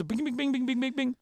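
rapid high-pitched bing noises; pinball bings. Retold or imitated. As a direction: imitate a pinball machine pinging around, high. Mimics a pinball machine.

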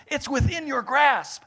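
slow, loud, high peak of the sermon; preaching climax. Acted. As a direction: drive it home to the crowd.

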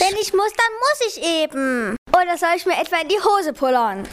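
put-on high, indignant childish protest; defiant. A narrator's reading. Pupsi insists on going to the toilet, defiant. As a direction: protest shrilly like a cornered kid. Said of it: "Pupsi wehrte sich".

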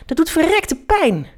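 high-pitched complaint of pain; pained shout. A narrator's reading. Felix complains to Julius about the blow, crying out. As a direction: cry out high in indignant pain. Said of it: "schreeuwde Felix".

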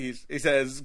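ordinary talk

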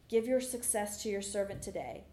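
ordinary talk, nothing marked

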